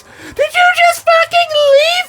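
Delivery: very high, wailing mock shriek; mock panicked partner. Imitating partner through the closed door, mock panicked shriek. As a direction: shriek it like an abandoned, hysterical partner. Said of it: "in her perspective, what I immediately hear".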